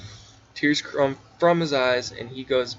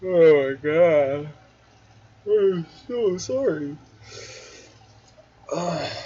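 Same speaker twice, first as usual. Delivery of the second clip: weary sigh; 4 semitones higher, 4 dB louder, slower than usual. Real, not acted. Reader sighing, drawn out.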